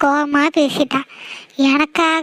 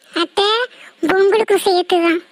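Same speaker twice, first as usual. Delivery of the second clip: ordinary delivery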